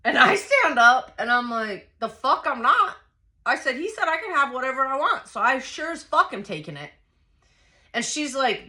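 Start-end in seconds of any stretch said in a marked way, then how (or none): 2.0-6.9 heated, raised-pitch defiance; defiant retort. Retold or imitated. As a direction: stand your ground in a retold fight. Acted standoff with grandmother.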